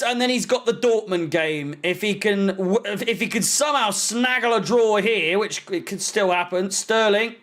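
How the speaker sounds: ordinary talk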